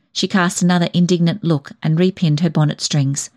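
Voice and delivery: ordinary delivery, nothing marked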